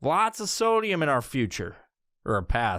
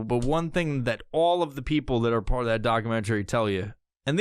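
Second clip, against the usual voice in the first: usual speaking voice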